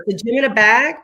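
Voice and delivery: usual speaking voice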